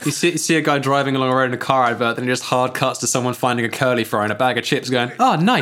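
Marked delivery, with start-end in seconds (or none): 5.2-5.6 delighted ad voice. Acted. Ad character delighted by curly fry.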